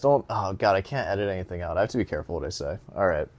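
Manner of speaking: usual speaking voice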